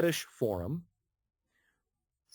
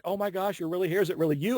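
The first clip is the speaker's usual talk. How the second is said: mildly gushing, higher-pitched fan disbelief; imitated fan amazement; 7 semitones higher, faster than usual. Forum fans to the actor who logged in, imitated amazement. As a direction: lift the pitch for starstruck questions, lightly imitated.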